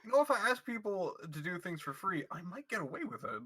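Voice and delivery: Lucas voice. Acted. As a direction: perk up as the eager customer. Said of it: "Lucas the Ponners".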